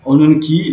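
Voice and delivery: Onun qui called out high and loud, weight on qui; teacher calls out Onun qui loudly to warn of the exception; emphasis. Shown as a demonstration. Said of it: "il n'y a pas d'harmonie de voyelles".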